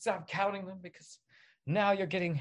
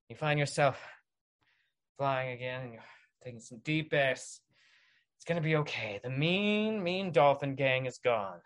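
ordinary talk, nothing marked

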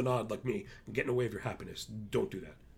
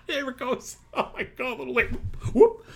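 laughing whoop; 12 semitones higher, 6 dB louder than usual. Real, not acted. Laughing at a sound cue.

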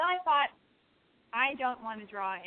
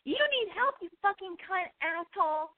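retold yell; 9 semitones higher, slower than usual. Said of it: "they're yelling at me".

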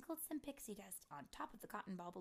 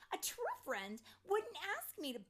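high, loud, indignant fairy voice; Tink's angry yell; 13 semitones higher, 9 dB louder than usual. A narrator's reading. Tink yells at Terrance, angry. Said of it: "change my voice from Tink's voice".

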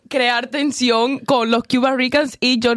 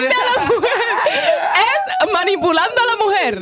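incredulous laughing; 10 semitones higher than usual. Real, not acted. Shocked at the seduction advice.

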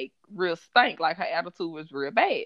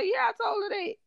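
mock squeal; faster than usual. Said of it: "She said".

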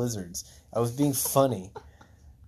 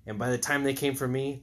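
ordinary talk

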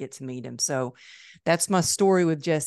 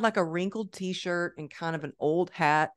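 ordinary talk, nothing marked

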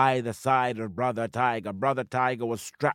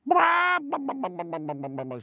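clucking hen cry; 6 semitones higher than usual. Acted. Sister Chicken's scoffing cry.

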